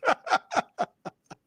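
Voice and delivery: loud, high-pitched burst of laughter; amused laughter. Real, not acted. Game master laughing at a player's quip, amused.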